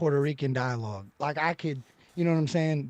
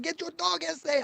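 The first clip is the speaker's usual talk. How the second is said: high mocking quote; 10 semitones higher, 7 dB quieter than usual. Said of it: "they said".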